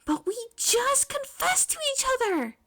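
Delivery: high, pleading protest; indignant whine. A narrator's reading. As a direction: protest like it's deeply unfair. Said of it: "May balked".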